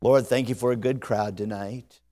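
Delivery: low, soft, earnest prayer voice. Real, not acted. Preacher praying over the congregation before the sermon. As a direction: pray quietly and sincerely.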